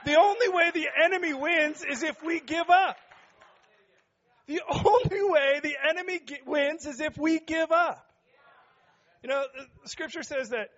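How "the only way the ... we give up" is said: high, loud rallying cry; preaching shout. Real, not acted. Pastor fires up congregation with a loud high shout. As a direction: fire up the room, full voice.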